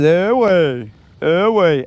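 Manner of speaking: drawn-out, rise-and-fall cartoon bear call; brother as Yogi Bear. Showing how his brother sounds like Yogi, cartoon impression. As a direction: swoop up and down, imitating a bear-like brother. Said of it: "But he just says".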